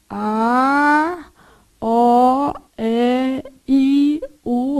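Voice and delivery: each vowel held and set apart; reciting vowel scale. Shown as a demonstration. Teacher shows class the little scale, vowels drawn out singly. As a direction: step through the vowels one by one. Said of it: "A sua escalinha aqui".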